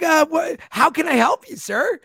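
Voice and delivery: high, loud, retold fluster; flustered surprise. Retells flustered reply to manager.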